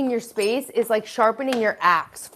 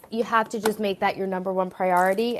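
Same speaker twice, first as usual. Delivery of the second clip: ordinary talk, nothing marked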